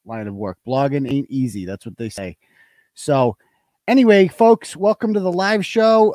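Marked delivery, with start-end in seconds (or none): 3.9-6.1 loud, high host greeting; show host welcome. Acted. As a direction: announce like a showman.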